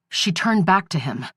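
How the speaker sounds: usual speaking voice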